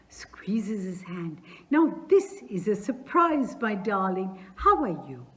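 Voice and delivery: high, loud, gushing welcome; a hearty landowner overjoyed to see his neighbour; effusive old landowner. Acted. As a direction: greet him like a long-lost son. Chubukov greets Lomov effusively.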